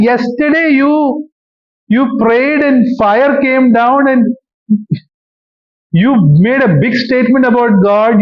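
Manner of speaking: whining despair. Acted. Elijah whining to God that he wants to die. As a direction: moan it like a defeated prophet begging to quit.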